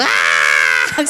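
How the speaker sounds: a long, drawn-out scream of pain; retold scream. Telling the congregation how she screamed at the hospital, a long pained cry. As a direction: let out a slow cry as if the pus is being squeezed. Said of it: "소리 지르면서".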